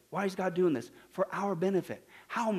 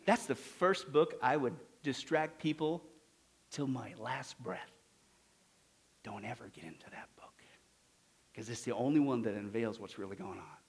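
playing Satan; 7 semitones lower, 7 dB quieter than usual. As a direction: drop to a near-whisper, plotting in secret. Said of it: "if I was Satan or demons".

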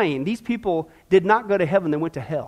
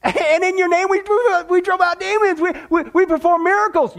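higher, pleading, stammering voice of the damned; desperate lost soul; 7 semitones higher than usual. Acted. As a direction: beg like someone who just learned they are lost. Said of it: "put some emphasis behind these words".

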